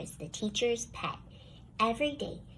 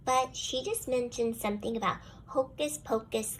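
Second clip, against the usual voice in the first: plain reading voice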